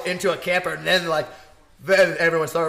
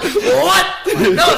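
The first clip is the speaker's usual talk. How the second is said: squeaky high "what" through laughter; laughing disbelief; 10 semitones higher, 8 dB louder than usual. Real, not acted. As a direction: laugh out the question in shock. Disbelieving mom on the phone.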